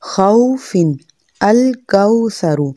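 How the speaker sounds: slow Arabic खौफिन and अल कौसरु; rounded aw. Shown as a demonstration. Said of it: "अरबी लहजे में हम कुरान को पढ़ेंगे".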